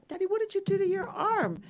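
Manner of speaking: high, loud, alarmed question to Dad; alarmed daughter. Retold or imitated. Daughter at dinner spots dad's bandaged arm, alarmed.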